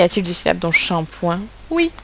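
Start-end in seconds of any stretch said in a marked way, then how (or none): none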